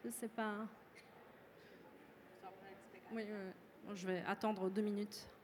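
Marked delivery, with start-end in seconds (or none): none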